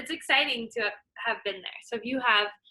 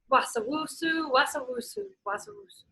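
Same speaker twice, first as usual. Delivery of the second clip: higher sliding hiss-like chant; slithering sound word; 6 semitones higher than usual. Acted. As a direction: slither the words.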